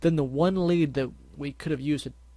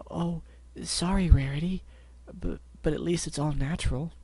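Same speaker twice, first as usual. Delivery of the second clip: timid pony voice; 4 semitones higher than usual. Acted. Fluttershy apologizing timidly.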